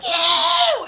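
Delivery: drawn-out yell leaping up high; retold all-out yell. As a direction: re-enact screaming it with everything you have. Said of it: "as loud as I could".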